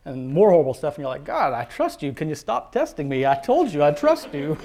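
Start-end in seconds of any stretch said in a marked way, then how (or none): 1.2-4.6 exasperated plea, building louder into a laugh; mock exasperation. Believer protests God's repeated testing, mock exasperation.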